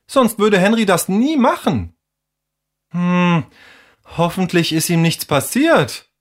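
high-pitched, worried character voice; concerned young friend. Acted. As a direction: fret about a missing friend, voice lifted high.

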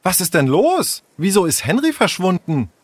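high-pitched, excited questioning; agitated. Acted. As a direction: demand answers in alarm. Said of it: "Aufgeregt riefen Tessa und Jule durcheinander".

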